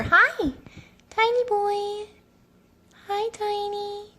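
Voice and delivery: high, soft cooing. Real, not acted. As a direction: coo softly to the tiny cat. Greeting the kitten.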